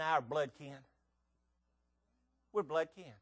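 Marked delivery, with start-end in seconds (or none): none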